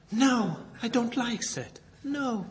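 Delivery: normal talking voice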